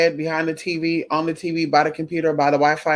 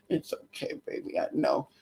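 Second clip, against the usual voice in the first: hushed, soothing whisper to a pet; soft whisper; 3 semitones lower, 12 dB quieter than usual. Real, not acted. Owner whispering to her frightened pet, hushed and soothing.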